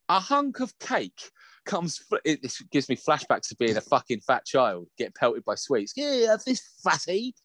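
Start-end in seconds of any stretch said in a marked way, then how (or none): 6.0-7.3 high-pitched bully's jeer; mock taunt. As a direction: jeer like a kid pelting someone. Childhood bullies pelting him with sweets.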